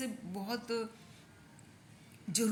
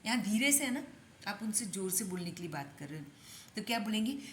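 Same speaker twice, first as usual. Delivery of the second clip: ordinary delivery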